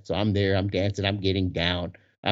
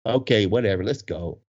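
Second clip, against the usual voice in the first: usual speaking voice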